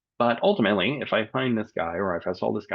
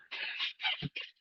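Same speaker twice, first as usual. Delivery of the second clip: cough; 10 dB quieter than usual. Real, not acted. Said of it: "Excuse me".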